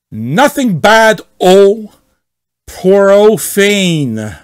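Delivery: hammed-up offended poster; angry member sneering at the rules; mock outrage. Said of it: "read as much as possible like him".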